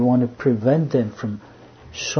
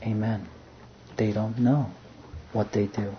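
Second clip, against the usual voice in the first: soft, much quieter, settled amen; quiet agreement; 3 semitones lower, 9 dB quieter than usual. Acted. The Father answering his Son, soft and calm. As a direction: echo the prayer gently, in calm assent.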